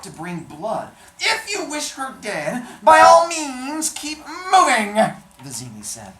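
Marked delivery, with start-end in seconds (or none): 1.2-5.0 high, loud, slow threatening dwarf; hunchback dwarf voice. Acted. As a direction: threaten him, high and drawn out. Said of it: "that voice was actually pretty good".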